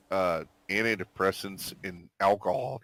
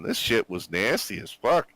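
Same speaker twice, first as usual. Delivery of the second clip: ordinary talk, nothing marked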